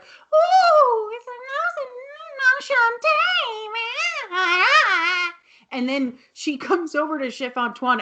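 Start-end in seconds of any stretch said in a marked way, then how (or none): 0.0-5.3 high, slow, flustered squeal; very high, drawn-out nervous coo; flustered Mrs Garrett. Retold or imitated. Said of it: "she's like".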